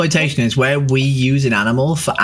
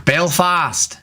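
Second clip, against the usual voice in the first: put-on slow, higher Belfast accent; drawn-out Northern Irish vowels; 4 semitones higher than usual. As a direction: pose as a Belfast local. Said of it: "told to do a Northern Irish accent".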